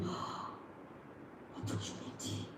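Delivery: hushed, breath-only mock amazement; awed whisper. Applicant overhearing news of a sales opening, awed whisper. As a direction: whisper it as a secret discovery. Acting out secret discovery of opening.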